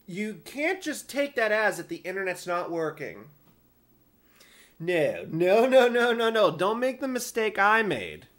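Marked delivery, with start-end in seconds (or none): 4.8-6.5 higher, louder rapid string of no's; emphatic no. Real, not acted. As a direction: stop them before they make the mistake. Warning listeners not to err.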